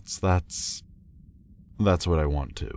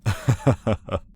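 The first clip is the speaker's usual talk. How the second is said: higher, louder burst of chuckling; short laugh; 6 semitones higher, 4 dB louder than usual. Acted. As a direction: chuckle briefly at the other person. Amused reply to being interrupted.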